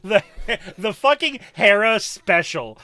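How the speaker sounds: loud laughing through the words; laughing hard. Real, not acted. Host laughing at her friend's move, loud laughter. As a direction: cackle while naming the Hera special.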